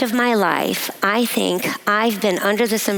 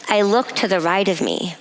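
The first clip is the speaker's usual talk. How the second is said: ordinary delivery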